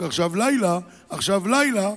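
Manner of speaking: ordinary delivery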